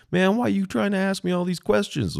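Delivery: exasperated imitated husband; annoyed man. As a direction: whine it like a fed-up husband. Grumpy husband on phone.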